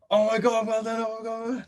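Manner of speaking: gushing, high-pitched squeal of delight; excited friends. Retold or imitated. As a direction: squeal it like thrilled mates. Friends react to his win.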